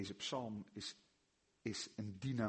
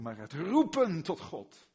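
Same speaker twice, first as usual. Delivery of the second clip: "roepen tot God" called out louder, "roepen" hit hard; 7 semitones higher, 12 dB louder than usual. Shown as a demonstration. Preacher showing his congregation a loud cry to God. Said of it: "niet per se om het volume".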